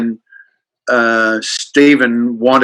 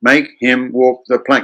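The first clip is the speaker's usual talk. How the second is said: ordinary talk, nothing marked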